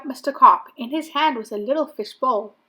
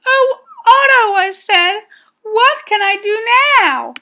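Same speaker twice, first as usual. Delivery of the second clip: high, loud wail of dismay; dismayed cry; 11 semitones higher, 9 dB louder than usual. A narrator's reading. Boy to his overgrowing fish, high dismayed cry. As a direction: cry out in helpless alarm.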